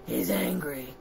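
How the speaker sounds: soft, low-pitched statement; low and quiet. A narrator's reading. Reader introducing the angry monster to children, low and quiet. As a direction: say it low and softly. Read low, then escalated louder.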